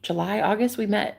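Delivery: ordinary delivery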